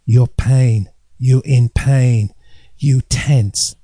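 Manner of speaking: put-on slow, broken English in a Chinese accent; Chinese accent impression. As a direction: voice the acupuncturist's Chinese accent, very slowly. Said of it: "she said".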